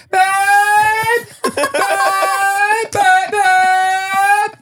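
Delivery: high, loud mock shriek for help; hammed-up high-pitched yelling; imitated scream. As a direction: shriek it like a partner yelling from the bathroom. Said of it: "she screams at me".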